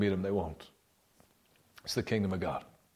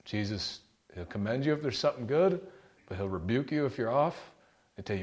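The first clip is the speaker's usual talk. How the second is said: ordinary talk, nothing marked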